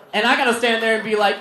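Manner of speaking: usual speaking voice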